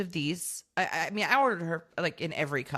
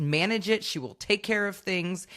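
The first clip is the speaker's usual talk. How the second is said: ordinary talk, nothing marked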